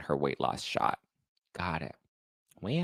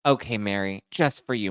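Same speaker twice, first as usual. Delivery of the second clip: ordinary talk, nothing marked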